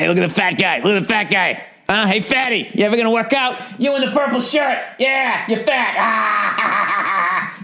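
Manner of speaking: high, mocking dunk-tank clown heckle; bad heckler impression. Clown in the dunk tank mocking passersby, high jeering heckle. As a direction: taunt the crowd like a carnival heckler. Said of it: "I'm doing a bad impression of it".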